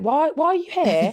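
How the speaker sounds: high, slow incredulous question; stunned to see her walk in; mock disbelief at the client; shocked why. Shocked at client returning early.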